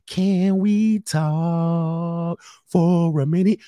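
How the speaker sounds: drawn-out mock plea to talk; slow mock plea. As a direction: stretch it out like a needy partner. Mimics woman wanting talk.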